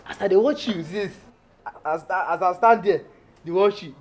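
usual speaking voice

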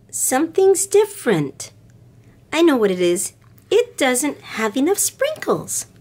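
lightly voiced little girl complaining; little girl Lisa. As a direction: lift up into a little girl's voice.

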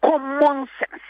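Common sense stretched slow and high; emphasis on common sense. Shown as a demonstration. Guest stressing Common sense to the host, slow and high. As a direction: lean hard on Common sense, slowly. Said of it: "I'm placing emphasis on this word".

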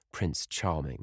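ordinary delivery, nothing marked

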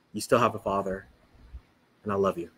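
low, soft fatherly comfort; tender reassurance. Retold or imitated. Father in heaven to a grieving daughter, tenderly and softly.

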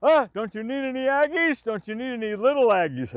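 high, loud playful holler; excited shout asking for little Aggies; mock call out. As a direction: tease him from the rock, calling out. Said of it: "Go, he'll".

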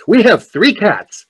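put-on slow, high little boy's voice. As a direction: imitate a small boy announcing it proudly to the reader. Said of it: "there was a little boy".

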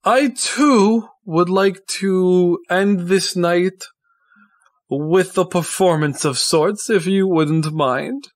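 theatrical, flourishing address, starting high; showman Tristan, courteous. Acted. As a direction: thank the guests like a grand performer. Tristan addresses the ball as performer.

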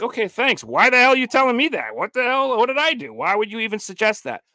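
high, baffled comeback to an unwanted reminder; exasperated retort. Acted. Listener snapping back at unsolicited moral advice, exasperated. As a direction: protest in high-pitched disbelief.

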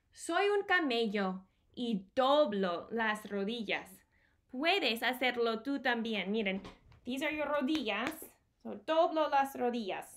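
usual speaking voice

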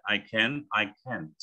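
American can't with the vowel of can; American can't, lightly ending on T; American accent. Shown as a demonstration. Teacher shows the T is there, American accent. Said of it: "Kalau American, memang bunyinya T ya".

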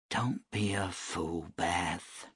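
slow, soft words to herself; talking herself down under her breath; quiet self-talk. A narrator's reading. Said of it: "she told herself".

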